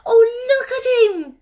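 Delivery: high, loud delighted gasp; exclaimed. Acted. Mary delighted by the robin, high excited exclaim. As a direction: burst out in wonder. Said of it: "exclaimed Mary".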